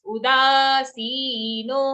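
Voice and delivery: उदासीनो with daa, see and no all held long; chanted उदासीनो, drawn out after a short u; long sounds. Shown as a demonstration. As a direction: stretch the three long vowels of उदासीनो. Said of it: "long sounds".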